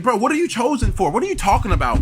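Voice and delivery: ordinary delivery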